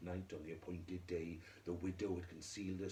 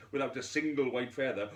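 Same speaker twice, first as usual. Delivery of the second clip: ordinary delivery, nothing marked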